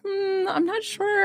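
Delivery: high, slow, doubtful hum; imitating hesitant client. Streamer mimics client who needed convincing, high and hesitant. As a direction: drag it out high, unconvinced. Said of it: "you were kinda like".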